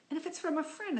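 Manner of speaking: ordinary delivery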